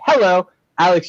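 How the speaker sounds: HELLO in a put-on English accent; HELLO as an amateur English greeting. Shown as a demonstration. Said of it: "say hello with an English accent".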